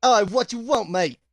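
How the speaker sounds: loud, high Cockney bark of a greeting; a rough London wide-boy voice, thick Cockney; thick Cockney accent. Acted. Tour guide answers the party in thick Cockney. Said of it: "this thick Cockney accent".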